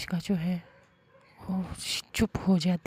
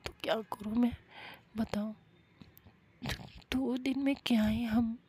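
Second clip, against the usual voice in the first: tearful; 4 semitones higher than usual. Acted. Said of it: "वो रोने लग जाती है अजीब सा".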